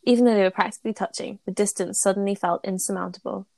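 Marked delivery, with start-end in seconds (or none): none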